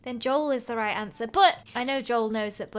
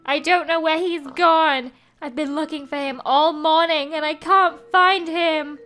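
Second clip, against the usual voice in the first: hammed-up worry for the lost cat; upset Joel; 4 semitones higher, 8 dB louder than usual. Joel NPC missing cat.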